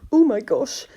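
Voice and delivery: ordinary delivery, nothing marked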